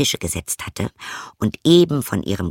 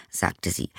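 usual speaking voice

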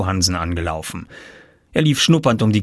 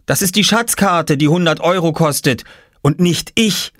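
put-on higher, insistent child voice; Oskar correcting; 8 semitones higher, 3 dB louder than usual. A narrator's reading. Oskar insisting the map is for sale, insistent. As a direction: set them straight.

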